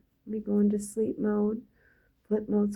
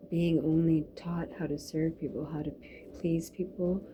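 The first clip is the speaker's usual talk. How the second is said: ordinary delivery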